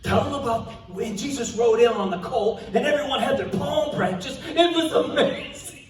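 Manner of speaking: loud, high and gushing; thrilled outburst. Acted. Disciple begs partner to tell about the colt, thrilled. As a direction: burst in, overflowing with excitement.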